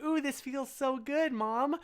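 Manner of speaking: high, gushing child voice, mildly acted; delighted kid. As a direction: coo it like a happy little kid. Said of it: "And I was like".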